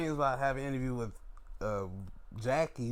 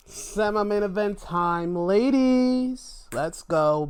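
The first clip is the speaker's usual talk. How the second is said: hype announcing; 6 semitones higher, 8 dB louder, slower than usual. Real, not acted. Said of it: "hyping the next match".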